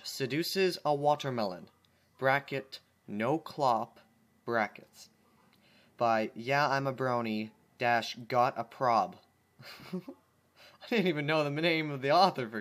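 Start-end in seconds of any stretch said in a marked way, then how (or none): none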